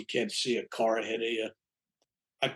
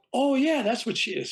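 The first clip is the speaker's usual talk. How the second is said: excited recognition; 10 semitones higher than usual. Retold or imitated. Girl recognizes the picture.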